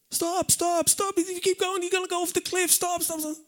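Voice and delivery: high, urgent "stop, stop, stop" at the cliff; mock screaming warning. Warning drivers off the cliff, high urgent cries. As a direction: wave drivers down, voice pitched high. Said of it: "you start screaming".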